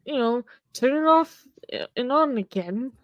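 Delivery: playful Indian tech-support voice; Indian accent. Pretend. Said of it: "Were you doing an Indian accent there".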